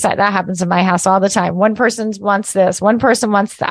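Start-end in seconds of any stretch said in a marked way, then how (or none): none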